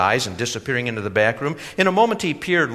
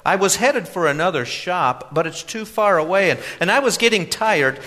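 put-on high, loud eager young man; earnest young customer; 9 semitones higher, 5 dB louder than usual. A narrator's reading.

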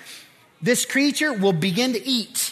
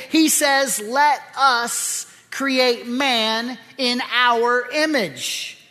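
preaching; 6 semitones higher, 4 dB louder than usual. Acted. Preaching on Genesis.

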